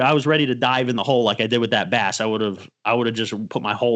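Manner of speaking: usual speaking voice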